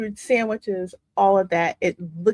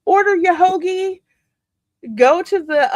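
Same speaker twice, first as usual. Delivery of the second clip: much higher, fast and louder; excited; 10 semitones higher, 5 dB louder than usual. Real, not acted. Vlogger compares stall to Italian market, excited and high. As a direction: gush about the market.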